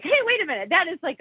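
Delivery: sudden high excited hey, wait a minute; surprised realization. Retold or imitated. Hearing something new on the radio, excited high voice. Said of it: "I'm like".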